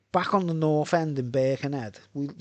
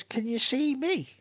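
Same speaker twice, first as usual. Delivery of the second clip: very high, slow mimicry; high, stretched-out woman's voice; imitating ex-girlfriend; 11 semitones higher, slower than usual. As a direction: draw her question out, pitched right up. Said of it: "She says".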